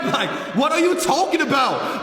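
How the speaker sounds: high, half-laughing mock outburst; exasperated rant. Storyteller telling off the drunk girl in his face, mock-angry high rant.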